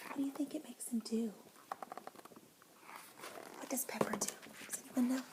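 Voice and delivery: quick hushed question; whispering. Real, not acted.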